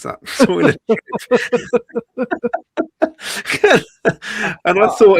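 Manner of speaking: high-pitched laughter through the words; cracking up as he says it; laughing. Real, not acted. Telling the host about the costly toilet trip, laughing hard.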